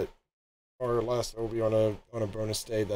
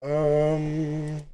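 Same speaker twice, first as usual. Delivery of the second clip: voice changer; 4 semitones higher, 3 dB louder than usual. Real, not acted. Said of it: "let me get the voice changer off".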